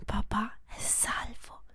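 slow, soft whisper; hushed relief. Acted. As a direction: breathe it out in relief.

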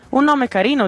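embarrassed. A narrator's reading. Oniria complimenting the bird's name, mildly embarrassed. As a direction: offer it politely, a little embarrassed. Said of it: "più imbarazzata di lui".

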